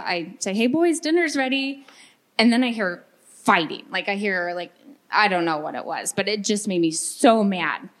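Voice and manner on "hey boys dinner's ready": retold call. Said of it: "And so I yell".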